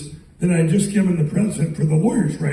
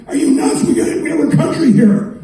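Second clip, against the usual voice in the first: high-pitched mock yell at moderate volume; imitated screaming; 9 semitones higher than usual. Almost in tears at this guy, retold screaming. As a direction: plead in a raised pitch, as if yelling. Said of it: "I'm screaming at him".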